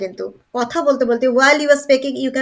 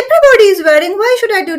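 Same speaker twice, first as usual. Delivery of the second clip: higher, louder imagined complaint; protesting objection; 7 semitones higher, 6 dB louder, much faster than usual. Acted. Teacher voicing a reluctant student's doubt, high and protesting.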